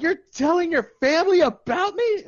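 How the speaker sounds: high, loud mock disbelief; imitated alarm. Girl learns he told his family, imitated alarm. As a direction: squeal it as her scandalized question.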